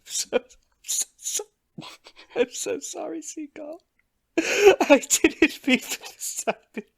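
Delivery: high, wailing mock sobbing; hammed-up grief. [name] mourning his crab pal, hammed-up wail. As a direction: overplay the mourning, half laughing.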